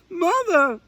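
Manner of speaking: very high, loud frantic shriek. A narrator's reading. Little Owl lost and alone, high frantic shriek. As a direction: call out alone in the dark woods. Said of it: "she shrieked".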